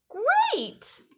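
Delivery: very high, excited exclamation; eager acceptance. Acted. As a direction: squeal it out with delight. Tiny eagerly accepts help.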